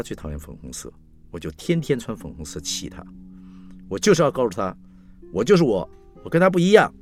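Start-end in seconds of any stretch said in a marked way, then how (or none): 3.9-6.9 loud, rising to high and emphatic; defiant declaration. Acted. Daughter declaring she differs from mother, loud defiance. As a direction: proclaim it defiantly, building with each phrase.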